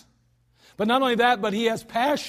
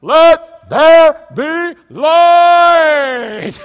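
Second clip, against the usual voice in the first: very slow, high shouted proclamation; booming drawn-out shout; dramatic yell; 8 semitones higher, 16 dB louder, much slower than usual. Shown as a demonstration. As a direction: stretch out and bellow every word. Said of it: "I don't think God said".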